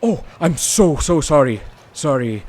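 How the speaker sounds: apologising profusely after spilling coffee; flustered apology. Acted. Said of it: "I go".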